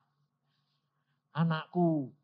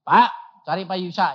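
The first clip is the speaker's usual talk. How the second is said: raised, higher caller voice; loud phone call; 5 semitones higher, 11 dB louder than usual. Retold or imitated. Bank caller loudly asking for Pak Yusa on phone.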